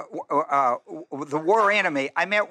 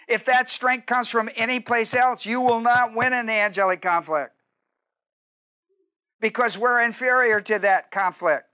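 raised preaching; 4 semitones higher than usual. Acted.

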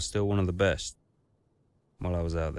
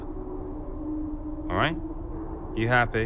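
defiant jab; 5 semitones higher, much slower than usual. Acted. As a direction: throw it back at him. Driver snaps back at boss.

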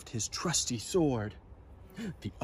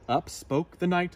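ordinary delivery

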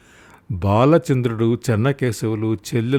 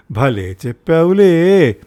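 slow, sarcastic mock praise; wife sneering at her husband's logic; 5 semitones higher, 5 dB louder, slower than usual. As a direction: drag it out slowly, full of scorn. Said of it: "అన్నది వ్యంగ్యంగా".

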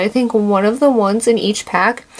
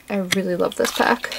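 "I really love this pack" said softer, dropping away; soft repeat; 4 semitones lower, 6 dB quieter than usual. Real, not acted. Vlogger repeating her love for the pack, softly.

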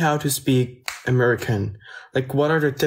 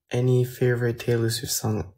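ordinary talk

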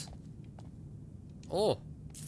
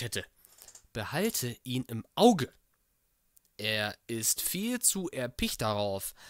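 louder, dramatic document reading; letter read aloud; 2 semitones higher, 7 dB louder than usual. Acted. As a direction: read the doctor's letter like a performance. (dramatisch vorgelesener Brief)

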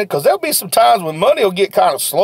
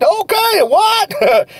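much higher put-on voice, puzzled; baffled customer; 13 semitones higher, much slower than usual. Customer puzzled by the seller's answer, much higher and confused.

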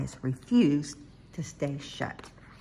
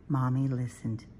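ordinary delivery, nothing marked

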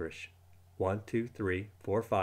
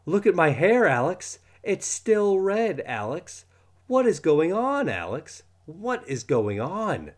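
high, loud frantic yell; 8 semitones higher, 5 dB louder, slower than usual. Acted. Boy yelling at his friend about his red hair. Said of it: "and yelled".

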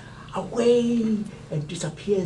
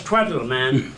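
dismissive farmer; 6 semitones lower than usual. Acted. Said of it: "the farmer says".

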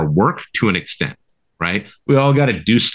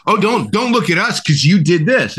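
lightly mocked deflection, pitched higher; mock whataboutism; 6 semitones higher than usual. Imitating opponents dodging with whataboutism, mock-defensive. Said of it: "Whataboutism is their favorite tactic".

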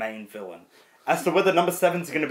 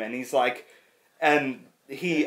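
ordinary delivery

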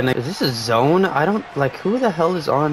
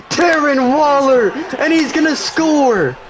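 high, loud, slow celebrating yell; excited shouting; 12 semitones higher, 3 dB louder, slower than usual. Real, not acted. Gamer celebrating a late touchdown, high loud shouting. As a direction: cheer the touchdown at full voice.

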